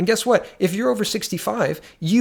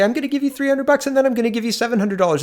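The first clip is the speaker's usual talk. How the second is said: higher, hurried mock promise; mock politician's promise; 6 semitones higher, faster than usual. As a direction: rattle off the promise in a raised voice, imitating him.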